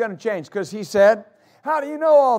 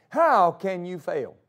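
ordinary talk, nothing marked

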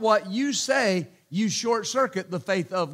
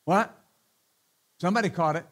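usual speaking voice

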